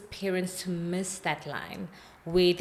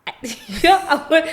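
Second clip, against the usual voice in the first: shocked laugh, much higher and louder; 7 semitones higher, 10 dB louder than usual. Real, not acted. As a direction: laugh through the words in disbelief. Shocked reaction to drunk child story.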